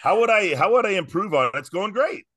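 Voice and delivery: high-pitched, incredulous protest; mock indignant. Mock-defends his short answer.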